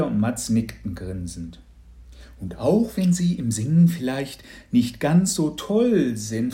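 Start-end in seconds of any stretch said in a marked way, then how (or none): 2.4-6.5 a disguised neighbour laying on the cheer; hammed-up gushing congratulations; gushing lottery lady.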